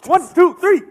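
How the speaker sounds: high, loud vigorous count. Real, not acted. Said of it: "That's a little better".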